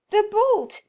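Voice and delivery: high, very loud strained shout; loud cry. Acted. Wife shouting up the stairs to her husband for help. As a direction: yell it up the stairs, panting. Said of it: "she cried loudly".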